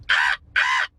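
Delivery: low, rasping crow caws; deep crow caw. Retold or imitated. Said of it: "Crows sound like".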